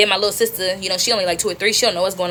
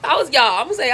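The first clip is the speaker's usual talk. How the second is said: ordinary talk, nothing marked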